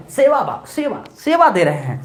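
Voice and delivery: ordinary delivery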